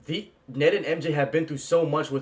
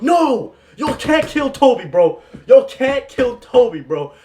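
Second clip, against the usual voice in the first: loud, high shout breaking into a laugh; yelling in protest; 9 semitones higher, 9 dB louder, slower than usual. Retold or imitated. Fan reliving yelling at the screen, laughing shout. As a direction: scream it like the hero can't die. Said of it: "I straight up yelled that out".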